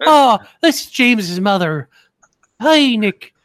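high, slow, loud put-on mother voice; a shrill put-on society mom greeting; mock mom voice. Host imagines cohost's mother greeting him, high slow put-on voice. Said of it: "I don't know what she sounds like".

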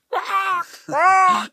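high squawking quack mimicry; imitating parrot squawk. Retelling the runaway parrot from the treetop, high squawk. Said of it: "of trees going".

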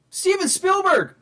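high, slow amazed reveal; surprised exclamation. Real, not acted. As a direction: announce it in disbelief.

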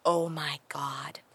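slow, imitated child's whisper; forbidden-word whisper. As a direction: whisper it like a dreadful secret.

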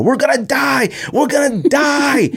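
high, slow panicked wail; panicked kid. Retold or imitated. Dad imitating his son's panic in the back seat, a high wail. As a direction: imitate a terrified kid crying out.

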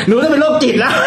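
high-pitched imitation of a laughing daughter. Daughter laughs at her own conclusion, high laughing imitation. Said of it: "แล้วเขาหัวเราะขึ้นมานะ".

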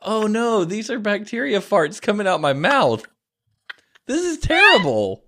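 high-pitched dramatized dismay; hammed-up high dismay; mock horror. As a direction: retell it as a horrified wail. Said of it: "I'm like".

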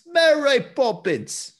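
very high, drawn-out mock Cockney. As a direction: stretch out a hammed-up bad British accent. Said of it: "just him saying Mary Poppins".

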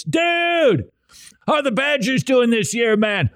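high, eager bro greeting; excited fan. Retold or imitated. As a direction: call out like a buddy fan.

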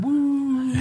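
high, drawn-out imitated siren "woo"; half-hearted mimic of a fire truck wail. Author acting out a book's street noise, mock siren. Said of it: "noise of a fire truck".

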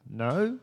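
"no?" lifted into an unsure question. Retold or imitated. Answering a stranger's ride offer, questioning "no?". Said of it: "With, like, a question mark".

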